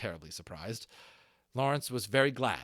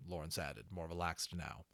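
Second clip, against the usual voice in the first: ordinary delivery, nothing marked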